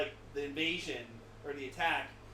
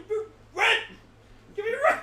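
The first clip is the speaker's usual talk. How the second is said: high shouting landlord demanding rent; gruff impatient scolding; 10 dB louder than usual. Retold or imitated. Landlord yelling.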